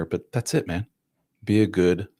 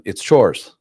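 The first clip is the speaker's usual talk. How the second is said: ordinary delivery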